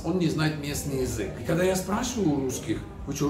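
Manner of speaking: usual speaking voice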